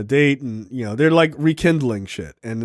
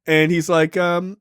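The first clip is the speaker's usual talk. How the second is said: normal talking voice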